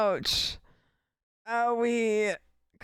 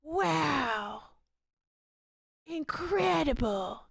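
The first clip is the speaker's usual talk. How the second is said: Coolidge impression; 5 semitones higher, much slower than usual. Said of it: "He's got a squint and sort of pucker".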